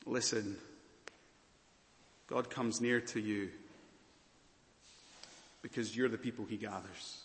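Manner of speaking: hushed reassurance, slowing at the end; tender quiet. Acted. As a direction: speak gently, as to one hurting person. Tender pastoral appeal to listeners.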